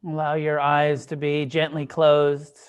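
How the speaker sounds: unhurried meditation guidance with long pauses; guided meditation. Acted. Teacher guiding a group to soften and relax, guided meditation. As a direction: ease them gently into stillness, leaving space between phrases.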